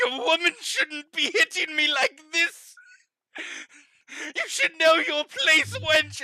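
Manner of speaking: high, indignant blubber; sobbing Sir Norwood. Acted. Slapped captive scolds the woman hitting him, tearfully indignant. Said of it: "start sobbing even more".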